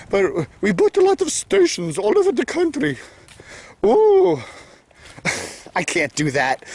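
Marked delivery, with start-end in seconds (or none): 0.1-3.0 high-pitched put-on Minnesota accent. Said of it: "I should do my Minnesota accent". 3.8-4.4 very high, loud "ooh"; Minnesota-accent "ooh". Acted. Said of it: "I should do my Minnesota accent".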